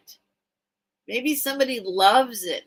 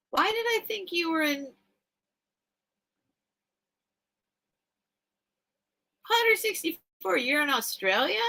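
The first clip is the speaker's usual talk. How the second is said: high-pitched astonished questioning; puzzled surprise; 8 semitones higher than usual. Real, not acted. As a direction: question it in high-voiced disbelief. Realizes viewer lives in Australia.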